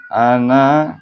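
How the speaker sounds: Ana sung loud with a rising pitch. Shown as a demonstration. Teacher showing where the melody rises, rising Ana. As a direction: lift the voice upward on Ana. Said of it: "naiknya di sini".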